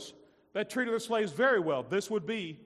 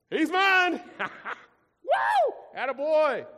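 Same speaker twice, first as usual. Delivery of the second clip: high, loud proud-parent whoop with a laugh; proud cheer; 9 semitones higher, 6 dB louder, much slower than usual. Retold or imitated. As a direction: cheer your kid on like you just saw him score. Proud parent cheering their child.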